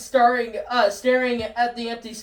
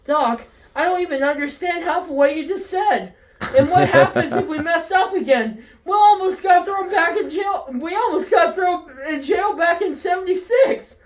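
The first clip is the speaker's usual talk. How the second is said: skeptical; 6 semitones higher, 4 dB louder than usual. Acted. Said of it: "Marty McFly skeptical".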